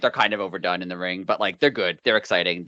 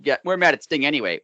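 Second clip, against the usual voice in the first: mocking the Bucks; 6 semitones higher, 4 dB louder than usual. Mocking the Bucks' excuse about Sting, high mock whine. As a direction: lightly sneer out their excuse. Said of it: "and then say".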